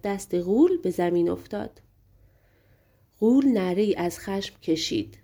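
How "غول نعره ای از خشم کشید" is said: usual speaking voice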